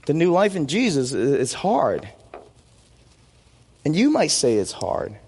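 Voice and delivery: usual speaking voice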